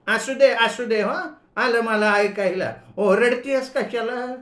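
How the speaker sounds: put-on high, loud, slowed old aunt scolding; shouting old aunt. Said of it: "आत्या एवढ्या मोठ्यांदा ओरडली".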